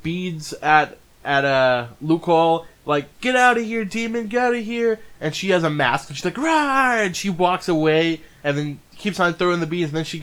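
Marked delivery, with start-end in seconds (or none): 3.2-5.0 higher-pitched, lightly acted shooing; mock scolding boy. Boy throwing beads at a supposed demon, mock scolding. 6.3-7.0 high-pitched mock monster rawr; playful roar. Masked woman scaring a little boy, playful roar.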